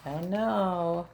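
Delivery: usual speaking voice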